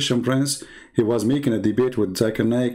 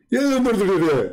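very high cartoon jabber; Zakir Naik; 9 semitones higher than usual. Fake Zakir Naik babbles at the baffled host, high cartoon voice. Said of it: "Somebody is just joking with you".

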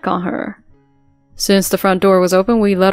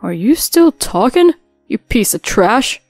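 angry father; 4 dB louder than usual. Acted. Father shouts at son.

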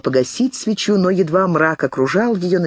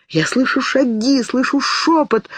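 hallucinating fear; 10 semitones higher than usual. A narrator's reading. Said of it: "галлюцинировала девушка".

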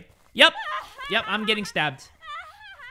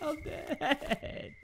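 deflated murmur; 7 semitones higher, 13 dB quieter than usual. Real, not acted. Character dies.

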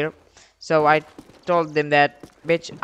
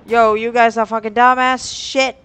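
scolding shout; 6 semitones higher, 7 dB louder than usual. Real, not acted. Scolding silent chat.

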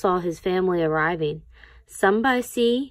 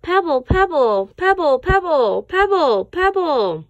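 high, loud sing-song calling of the name; family chorus calling his name; 8 semitones higher, 7 dB louder than usual. Acted. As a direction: call him across the ice. Family calling Pebble loudly.

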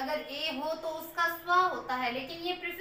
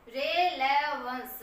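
slow demo of relevance; 2 semitones lower, 4 dB louder, much slower than usual. Shown as a demonstration. Teacher spelling out a word slowly for students. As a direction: stretch relevance out slowly, syllable by syllable. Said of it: "ये relevance होता है".

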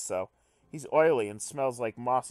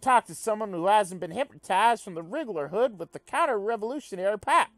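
higher, eager comrade voice; fervent alien revolutionary; 7 semitones higher, 5 dB louder than usual. Acted. Fawzer praising his new comrade, raised and fervent. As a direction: preach the revolution with glee.

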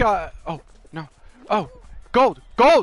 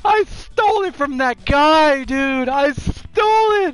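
raised, euphoric celebration; elated exclaiming; 3 semitones higher than usual. Real, not acted. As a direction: crow about stealing the win. Gloating over the win.

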